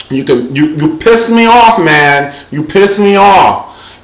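imitating an angry woman, high and drawn out; Evelyn. Imitating Evelyn from Basketball Wives, high irritated complaint. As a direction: raise the pitch, stretch the gripe. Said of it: "as she says it".

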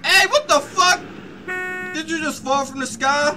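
surprised shout. Real, not acted. Character falls from sky.

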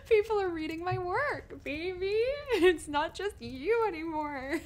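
very high, loud, squealed through laughter; giddy excitement. Acted. Telling partner people read their work, giddy excitement.

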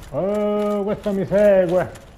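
usual speaking voice

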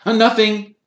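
hasty high-pitched denial; quick. A narrator's reading. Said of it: "said Harry quickly".

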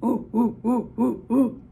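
low ape hooting; gorilla hoots. Acted. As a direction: hoot like a gorilla. Gorilla noise in story.

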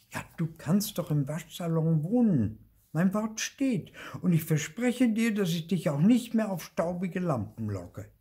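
high, lively promise; eager ghost companion. Acted. Marti offers Luzi the launderette, eager and high. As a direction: make a keen offer in a high voice.

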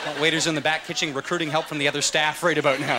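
normal talking voice

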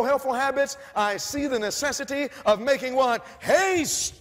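loud, high, slow prophetic reading; preaching. Acted. Preacher reading the call to leave the cities, loud and slow. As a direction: read it out slowly, like a warning.

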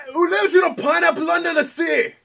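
high, loud hammy pirate; sing-shout it as a cartoon pirate. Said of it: "That sounds pirate".

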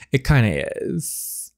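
plain reading voice

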